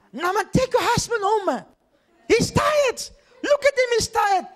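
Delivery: high, loud mocking demon voice; demon taunting. Demon shouting at the preacher's wife to take him home. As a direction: jeer at the pastor's wife like the demon.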